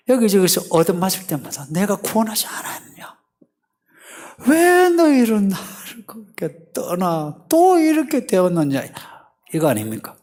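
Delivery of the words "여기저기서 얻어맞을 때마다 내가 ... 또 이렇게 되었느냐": exasperated reproach. Acted. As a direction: plead and scold as a wronged God, pitch soaring. Acting God's exasperated reproach.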